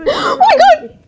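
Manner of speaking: high, slow shriek with a laugh; scared laughing scream. Real, not acted.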